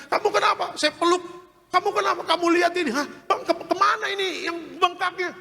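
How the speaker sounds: high, amazed wife's voice. Retold or imitated. Wife asks her husband where the swelling went, high and amazed. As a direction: sound like a startled wife asking what happened. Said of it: "Istri saya mengatakan".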